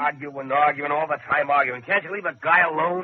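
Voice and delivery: sick of being pushed, snap back; exasperated complaint. Acted. Husband fed up with his wife's arguing, exasperated and loud.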